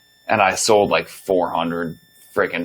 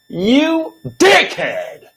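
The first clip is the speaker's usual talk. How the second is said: drawn-out furious yell, much higher and louder; angry outburst; 4 dB louder, much slower than usual. Retold or imitated. Cursing the manager to his face, slow furious yell. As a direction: explode at him slowly. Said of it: "I was so freaking angry".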